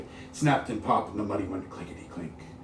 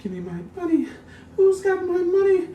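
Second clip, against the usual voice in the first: very high, very slow, louder moaning wail; ghostly wail; 14 dB louder, much slower than usual. Acted. Dead woman's ghost wants her money, high slow wail. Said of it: "The woman cried".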